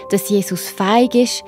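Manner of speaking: ordinary delivery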